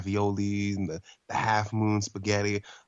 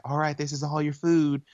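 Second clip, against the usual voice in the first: higher-pitched Southern waitress imitation; mock Southern accent, pitched up; 6 semitones higher, 4 dB louder than usual. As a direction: put on a Southern lady serving food. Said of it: "She has a really southern accent".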